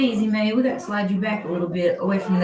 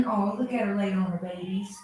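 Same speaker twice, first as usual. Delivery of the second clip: normal talking voice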